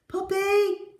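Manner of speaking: high shout of the name. Acted. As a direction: call out eagerly for the dog. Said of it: "Tim shouted".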